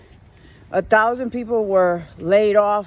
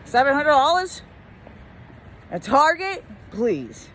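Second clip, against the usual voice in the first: higher, mocking dismissal; scoffing retort; 4 semitones higher than usual. Worker telling a customer seven hundred is nothing, scoffing. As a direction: scoff at the small amount. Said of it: "I'm like".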